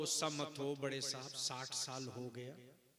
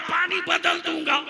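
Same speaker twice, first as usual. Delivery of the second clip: shouted high angry reply; angry retort; 14 semitones higher, 16 dB louder than usual. Retold or imitated. Maulvi snapping back at the preacher, angry shout. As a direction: bark it back furiously. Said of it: "गुस्से में मौलवी बोल पड़ा".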